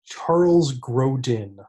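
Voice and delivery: "Grodin" said slowly and clearly, stressing GRO, ending -din; correcting a name. Shown as a demonstration. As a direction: spell out "Grodin" carefully as GRO-din, not Grogan. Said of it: "who's Charles Grogan?".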